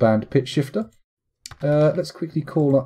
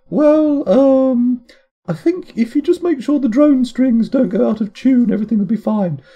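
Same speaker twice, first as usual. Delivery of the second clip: high-pitched, loud handler impression; Diana; 12 semitones higher, 6 dB louder than usual. Handler advising an agent on drone strings, high put-on voice. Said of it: "internet's finest impression of Diana".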